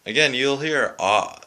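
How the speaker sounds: ordinary talk, nothing marked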